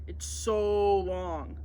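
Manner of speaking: very slow, drawn-out sleepy groan; tired groan. Acted. Jet-lagged boy groaning about the long train ride. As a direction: stretch it out, yawning and worn out. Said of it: "Bodhi groaned, yawning".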